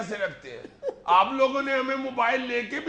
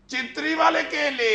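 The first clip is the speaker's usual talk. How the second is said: street hawker's call; 5 semitones higher, 5 dB louder than usual. Retold or imitated. Hawker calls out behind him.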